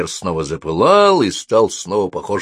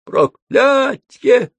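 very high, loud, drawn-out whine; whining curse; 6 dB louder, much slower than usual. A narrator's reading. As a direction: whine it out as a thwarted little demon. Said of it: "заскулил Кальцифер". (скулящий высокий возглас)

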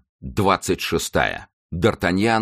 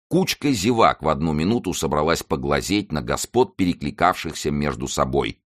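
usual speaking voice

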